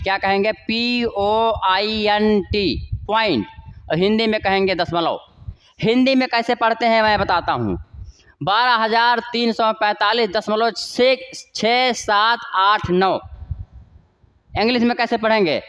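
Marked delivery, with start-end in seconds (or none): 0.5-2.9 slowly spelling P O I N T letter by letter; spelled out letter by letter. Shown as a demonstration. Teacher spells the word out for students, very slowly. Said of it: "English में कहेंगे पॉइंट". 11.5-13.2 slow, separate digits "छः सात आठ नौ". Shown as a demonstration. Teacher reads decimal digits to students, slow and separate. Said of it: "इनको हम कभी भी एक साथ नहीं पढ़ेंगे".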